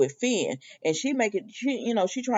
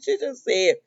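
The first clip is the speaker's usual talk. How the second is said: normal talking voice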